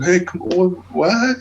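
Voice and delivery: high, incredulous "come on, what?"; disbelief. Retold or imitated. Baffled by the friendly band, high incredulous mock outburst. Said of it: "It's like".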